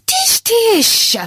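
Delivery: high scolding hen; dismissive tut. Acted. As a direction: brush him off like a fussy wife. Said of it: "his wife told him".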